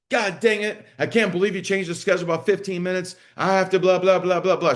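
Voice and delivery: high-pitched, heated rant from an upset mom; angry parent. Acted. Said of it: "Lakin's pissed off".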